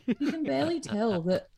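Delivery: normal talking voice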